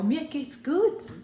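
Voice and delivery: slow, careful German; German phrase. Shown as a demonstration. Showing the audience a German reply, slowly in German. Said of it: "That is German".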